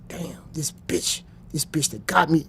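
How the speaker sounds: low whispered inner thought; hushed bitter muttering to himself; thinking to himself. Retold or imitated. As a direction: breathe it like a thought to yourself. Inner thought while shot, whispered.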